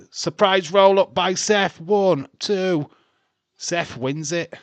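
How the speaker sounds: loud, high, thrilled play-by-play yell; excited call. Real, not acted. Calling the surprise pin for viewers, real excited yell. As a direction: shout the finish as it happens.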